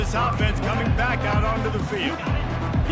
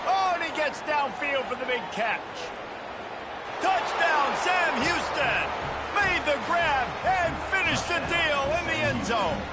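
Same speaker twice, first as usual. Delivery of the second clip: higher, rising play-by-play call; excited touchdown call; 6 semitones higher than usual. Acted. Big catch touchdown call.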